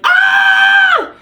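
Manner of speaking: high, loud shriek of pain; pinky toe taped back, piercing pain scream; retold scream of pain. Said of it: "I had never felt pain like that before".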